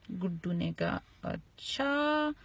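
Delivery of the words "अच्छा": long, slow, higher 'acha'; drawn-out reply. Acted. Guddu answers Tinku over the ball, drawn out. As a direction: stretch it out slowly, raised in pitch.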